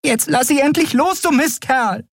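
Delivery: very high, tiny furious voice; small creature scolding a brute; angry little creature. A narrator's reading. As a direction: squeal the demand indignantly. Small Nelen attacks Keru to free friend.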